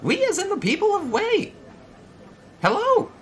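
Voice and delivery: very high, loud tour-guide voice; proudly showcase the town in a strained falsetto; high-pitched, over-eager town greeter; Cap. Acted. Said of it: "let's see if I can do his voice".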